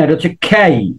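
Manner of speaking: খেই with a breathy aspirated kh and an ই glide. Shown as a demonstration. Teacher models the letter K for students, aspirated. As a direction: puff the খ in খেই. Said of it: "কে এর উচ্চারণ তো কে না".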